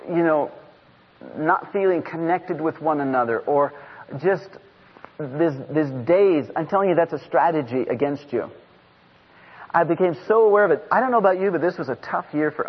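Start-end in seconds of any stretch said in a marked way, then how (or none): none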